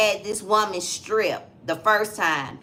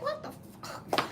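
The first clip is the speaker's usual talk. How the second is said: whispered shock; 14 dB quieter than usual. Retold or imitated. Retold shocked reaction.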